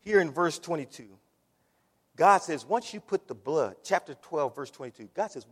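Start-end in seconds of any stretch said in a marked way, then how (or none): none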